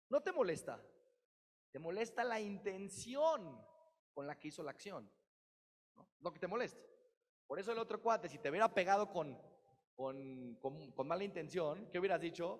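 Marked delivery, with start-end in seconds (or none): none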